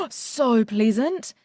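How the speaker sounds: slow, savoring, delighted; delighted gasp. Real, not acted. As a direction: breathe it out with real pleasure. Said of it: "I just got really excited".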